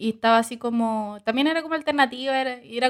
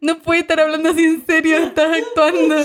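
incredulous retort; 6 semitones higher, 7 dB louder than usual. Retold or imitated. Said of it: "Y yo como".

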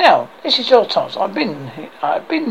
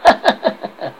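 high staccato chuckle; mock chuckling; 7 semitones higher than usual. French guards snigger at Arthur, mock chuckling. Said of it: "French guard's chuckling".

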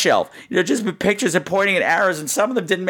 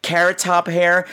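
ordinary talk, nothing marked

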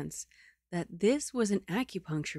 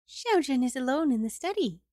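put-on young maid's voice; 7 semitones higher, faster than usual. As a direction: greet your mistress eagerly as a maid. Maid reports to madam.

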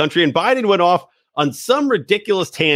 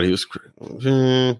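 trailing off; 2 semitones lower, slower than usual. Real, not acted. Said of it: "Yep, I lost my train of thought".

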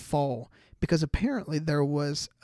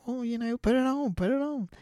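higher, eager prompting; nervous urging; 8 semitones higher than usual. Retold or imitated. Nervous fiancé urging her to wear the ring, high and eager. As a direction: nudge her to put the ring on.

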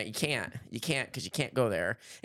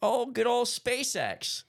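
high, slow mocking drawl; sarcastic; 12 semitones higher, much slower than usual. Real, not acted. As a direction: say it with heavy sarcasm.

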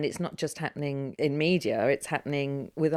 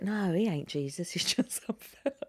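put-on London accent; 2 semitones higher, 5 dB quieter than usual. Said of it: "She went".

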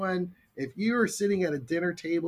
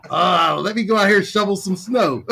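sarcastic; 3 semitones higher, 8 dB louder than usual. Retold or imitated. Sarcastic resigned teen reply.

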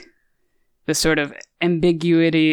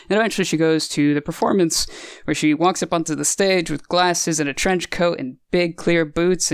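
ordinary delivery

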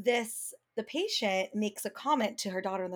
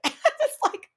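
laughing hard, pitch up and loud; can barely get words out through laughter; 12 semitones higher, 6 dB louder than usual. Real, not acted. Laughing while telling her co-host about the patient.

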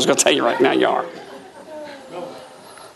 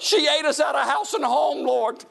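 high-pitched comic griping to the Lord; playful whining prayer; mock complaint; 9 semitones higher than usual. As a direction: plead your case in a hammed-up high voice.

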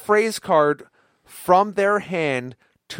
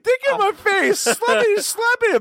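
mocking squeaky voice. Teasing a friend in a high squeal. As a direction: squeal it to tease. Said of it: "I'm like".

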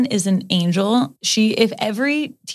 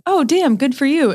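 impressed; 6 semitones higher than usual. Retold or imitated. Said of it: "And I was like".

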